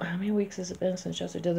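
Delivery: quick, hushed fretful muttering; fast nervous counting to yourself; mock anxious counting. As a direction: rattle it off under your breath like a worrier. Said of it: "You cannot sit and count".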